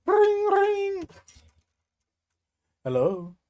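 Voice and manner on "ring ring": high sung ring ring; phone ring imitation. Pretends the comment is calling, high ring ring.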